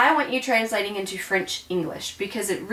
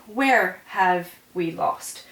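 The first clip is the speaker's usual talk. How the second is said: slow literal translation; 2 semitones lower, much slower than usual. Shown as a demonstration. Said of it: "literal translation after French".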